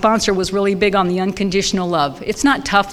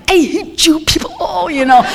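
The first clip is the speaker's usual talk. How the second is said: inner snarl; 8 semitones higher, 3 dB louder than usual. Retold or imitated. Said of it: "and I'm like".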